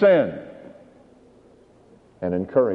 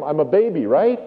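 ordinary delivery

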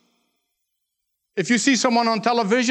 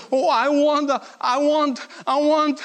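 higher-pitched mock wail, no real tears; crying spoiled boy; 6 semitones higher, slower than usual. Preacher imitating the spoiled boy to his congregation, mock crying. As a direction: imitate a child's insistent crying demand. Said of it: "And he kept on crying".

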